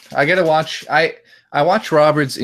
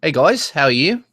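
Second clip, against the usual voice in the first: higher-pitched video-host impression, rising at the end; pitched-up impression with questioning lift; Woody; 4 semitones higher, 4 dB louder than usual. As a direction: greet viewers in a put-on accent. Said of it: "I could do Woody".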